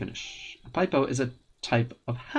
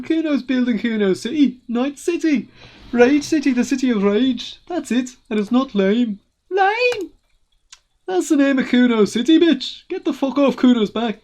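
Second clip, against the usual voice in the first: louder, higher brat boasting; cocky angry kid; 10 semitones higher, 7 dB louder than usual. Acted. Kuno boasting about Rage City to the cop, angry. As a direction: brag it up and tell him off. Kuno's proud angry rant.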